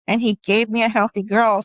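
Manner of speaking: normal talking voice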